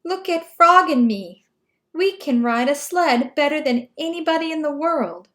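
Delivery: high, loud proud bragging; boasting. Acted. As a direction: show off to the crow. Toad boasting on sled.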